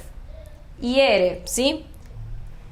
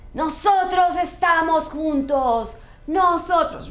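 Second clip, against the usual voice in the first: put-on higher, possessive girlfriend voice; jealous woman; 5 semitones higher than usual. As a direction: claim your man in front of everyone. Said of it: "if I'm a jealous woman".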